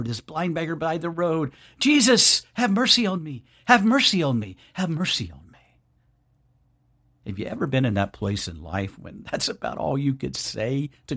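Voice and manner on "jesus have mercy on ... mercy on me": starts as a high pleading call, then fades; crying out. A narrator's reading. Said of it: "was cried out by this beggar".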